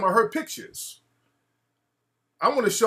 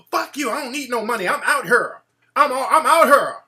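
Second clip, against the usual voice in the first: mock yell; 5 semitones higher, 6 dB louder than usual. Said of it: "I'm like".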